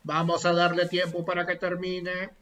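monótono y lento, imitando al que modera; voz de presidente parlamentario. Retold or imitated. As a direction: arrastra las palabras con calma de moderador. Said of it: "Y ahí se escuchaba". (parodia pausada de quien dirige el Congreso)

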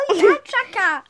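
normal talking voice

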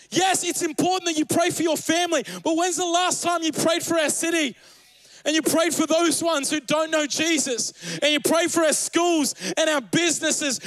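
fiery preacher urging the congregation to pray; preaching at full force. Acted. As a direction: preach it hard to the church. Preaching builds to climax.

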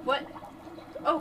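usual speaking voice

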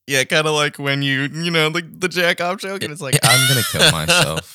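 high, loud mock dramatic; over-the-top comedian. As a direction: ham it up like a joke. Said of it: "And they're like".

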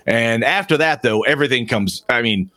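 normal talking voice

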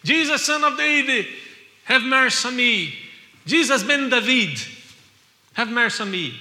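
high, loud pleading cry. Retold or imitated. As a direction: call out for mercy from the wayside. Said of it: "begins to cry out".

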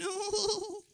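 put-on a frightened child whining no like a puppy; scared little kid. Kid refusing to give something up, high scared no. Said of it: "that thing that little kids do whenever they're scared".

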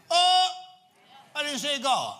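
high, loud pulpit outburst; preacher's exclamation. Real, not acted. Preacher exclaiming to the congregation about the body, loud and high. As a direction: cry it out high and forceful, pausing between phrases.

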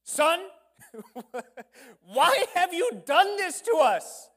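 high, loud, slow scolding, breaking into a laugh; angry mother. Acted. Mary scolds the boy Jesus in the temple, loud high furious. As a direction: let him have it like a frantic mom. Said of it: "she let everybody know".

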